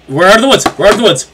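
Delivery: loud, fast, joyful cry; triumphant shout. Real, not acted. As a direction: shout it out in relief. Triumphant shout after escape.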